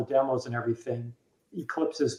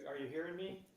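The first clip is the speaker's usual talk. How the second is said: muffled, far-off, tinny mic; faint hollow line like in a tube; bottom of a tube; 3 semitones higher, 19 dB quieter than usual. Real, not acted. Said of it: "You sound like you're at the bottom of a tube".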